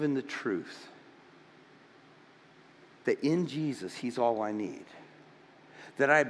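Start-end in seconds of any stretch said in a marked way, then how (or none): none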